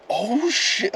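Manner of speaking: sudden high-pitched oh shit; shocked exclamation. Real, not acted. Reading traffic rules, realising the fines stack, real surprise. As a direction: jump way up in pitch, caught off guard.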